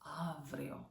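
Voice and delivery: avryo softly, with AV stressed; stress on first syllable. Shown as a demonstration. Teacher settling on the correct word, avryo softly stressed on AV. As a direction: say avryo as AV-ree-o, quietly. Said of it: "not avrio".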